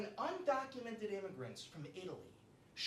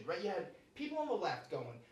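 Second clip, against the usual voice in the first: usual speaking voice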